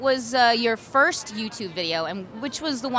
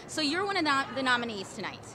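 usual speaking voice

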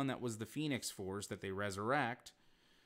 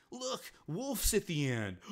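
high, slow, hammed-up announcement; mock soap-opera reveal, slow and high; mock dramatic reveal; 9 semitones higher, 5 dB louder, slower than usual. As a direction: spoof a cheesy cliffhanger, drawn out high. Mocking a lame cliffhanger reveal.

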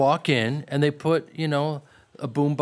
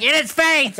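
mock Pesci order; 10 semitones higher, 5 dB louder than usual. Pesci voiced directing.

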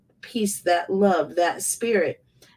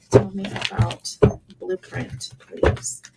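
hushed invocation; slower than usual. Acted. Repeating an invocation to spirit.

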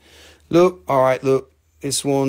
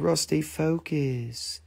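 softer, slow stern scolding; mock scolding Nicole; 10 dB quieter, much slower than usual. Nicole in the back telling Rusty to focus, soft, slow scolding. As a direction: scold him quietly and slowly. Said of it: "She said".

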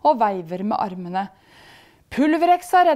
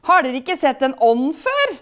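loud, raised spirit voice; yelling; 5 semitones higher, 7 dB louder than usual. A narrator's reading. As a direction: challenge them at full voice. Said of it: "skriker han".

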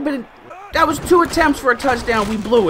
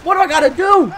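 higher, louder frustrated shout; exasperated yell; 7 semitones higher, 7 dB louder than usual. Real, not acted. Gamer yelling at his receivers' drops, exasperated shout. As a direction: vent at the team like you've had enough. Outburst at dropped touchdowns.

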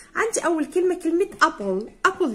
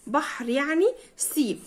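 ordinary talk

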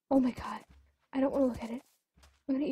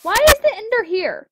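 high, loud frightened cry; shrill startled outburst; panicked yelp; 8 semitones higher, 11 dB louder than usual. Real, not acted. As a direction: blurt it out in real fright. Startled by Ender in house.

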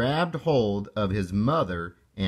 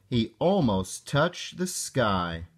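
usual speaking voice

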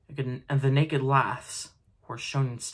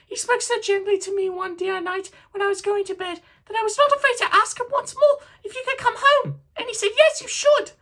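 put-on much higher, louder, laughing child voice; little girl; 8 dB louder than usual. A narrator's reading. Little Fan fetching her brother home, gleeful child voice. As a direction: bubble over like a delighted little sister. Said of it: "brimful of glee".

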